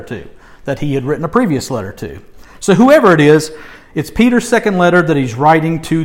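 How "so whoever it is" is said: usual speaking voice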